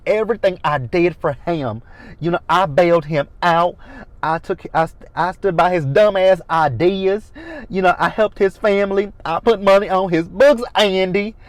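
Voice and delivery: high, loud mocking of her whiny accent; Karen's accent. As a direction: whine it out in her put-on accent, climbing. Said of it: "I hate that accent that she has".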